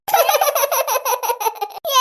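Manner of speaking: short rueful laugh at herself. Acted. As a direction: laugh off the failed prank. Prank backfired. (സ്വയം പരിഹസിച്ചുള്ള ചിരി)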